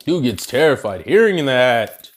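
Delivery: normal talking voice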